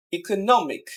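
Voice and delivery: "Economic" with stress on নো; stress before ending. Shown as a demonstration. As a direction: lean on নো in "economic", let মিক fall. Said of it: "শেষের আগের সিলেবলটাকে". ("ইকোনমিক"-এর নো-তে জোর)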